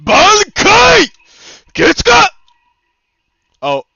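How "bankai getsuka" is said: high, loud battle cry; anime attack shout. Pretend. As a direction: shout the move name like anime. Calling out ultimate move.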